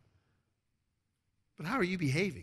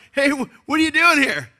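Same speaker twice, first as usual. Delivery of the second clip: high, loud reporter voice, pushy; mock reporter; 11 semitones higher, 13 dB louder than usual. Pastor acting out a march interviewer, high loud questioning. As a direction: play a brash street interviewer. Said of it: "People were interviewed in the first march".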